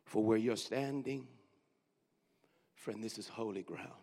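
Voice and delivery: soft, low repeat; hushed repeat of the verse. Shown as a demonstration. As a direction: hush it again for those who missed it. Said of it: "Let me say it one more time".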